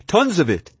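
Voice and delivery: high, excited exclamation; eager high-voiced cry. A narrator's reading. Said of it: "Murray cried".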